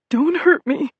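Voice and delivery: high scared squeal; squealing fear. Acted. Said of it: "he squealed".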